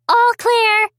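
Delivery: high, loud call. Acted. Molly telling the engineer the cows moved, loud call. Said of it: "she called".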